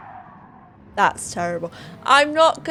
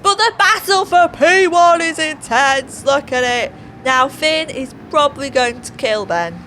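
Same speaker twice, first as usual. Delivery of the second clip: loud, raised-pitch play-by-play; race commentary; 5 semitones higher, 6 dB louder than usual. Acted. Commentator calling the battle for P one, excited race commentary. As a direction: call the fight for first with excitement.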